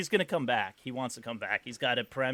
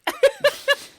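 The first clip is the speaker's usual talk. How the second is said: loud high cackle; high burst of laughter; 8 dB louder than usual. Real, not acted. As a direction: break into a laugh. Laughs at own joke.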